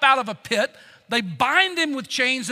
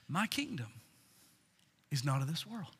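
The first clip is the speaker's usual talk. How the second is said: very soft, low and unhurried; hushed serene reply, with pauses; absolute peace; 9 semitones lower, 15 dB quieter than usual. Acted. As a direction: say it cool and untroubled, almost under your breath. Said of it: "in absolute peace, says".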